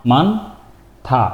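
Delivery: মানথা very slowly, split as মান, pause, থা; drawn-out teaching model of মানথা; syllable-by-syllable demo. Shown as a demonstration. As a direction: break মানথা in two with a long gap. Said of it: "আমরা একটু উচ্চারণ করে আসি".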